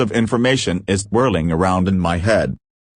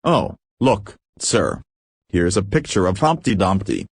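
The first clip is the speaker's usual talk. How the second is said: usual speaking voice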